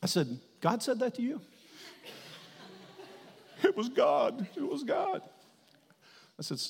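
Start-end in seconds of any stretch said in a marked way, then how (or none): 0.6-1.4 high-pitched incredulous question; acted disbelief. Preacher questions what God told the man, acted disbelief. Preacher doubts man's report. 3.6-5.2 high, hammed-up tearful wail; imitated sobbing. Sobbing man insists God spoke, mock crying. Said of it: "he is just sobbing".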